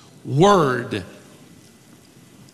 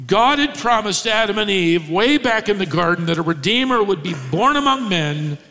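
normal talking voice

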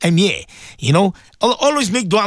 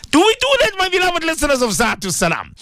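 rousing call; 10 semitones higher than usual. Real, not acted. Rousing question to listeners.

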